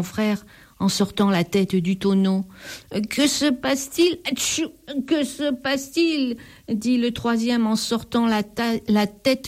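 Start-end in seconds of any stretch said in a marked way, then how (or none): 3.1-6.4 sneezy. Acted. Sneezing thief under straw.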